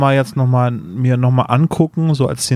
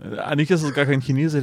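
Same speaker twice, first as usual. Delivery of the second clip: normal talking voice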